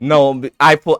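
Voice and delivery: ordinary talk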